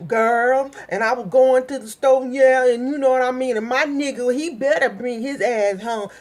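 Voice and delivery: loud, hammed-up sassy girlfriend voice. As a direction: put on a loud sassy slang voice. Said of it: "everybody don't talk like".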